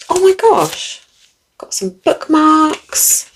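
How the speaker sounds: oh-my-gosh unboxing delight; thrilled gasp. Real, not acted. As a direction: gasp over the goodies, drawing it out. Unboxing surprise goodies.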